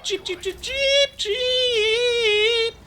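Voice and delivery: very high mock chick peeps; chick cheeping back. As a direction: peep back at Mark like a little chick. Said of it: "You're just a chicken, Mark".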